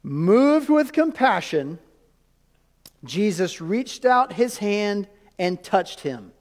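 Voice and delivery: very slow, deliberate Bible reading; measured, drawn-out reading for close attention; real slow reading. Shown as a demonstration. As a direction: read the verse out slowly, stretching each phrase. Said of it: "We're gonna read it real slow".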